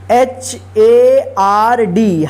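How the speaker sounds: letters spelled out slowly one by one; slow spelling. Shown as a demonstration. Teacher spells word letter by letter.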